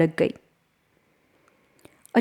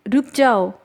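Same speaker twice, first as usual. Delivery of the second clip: higher, urgent call to halt; crying out; 4 semitones higher, 3 dB louder than usual. A narrator's reading. Elder sister calls to fleeing Lakshmi, crying out. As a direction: cry out to stop someone fleeing. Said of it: "उसकी अक्का की चीख निकली".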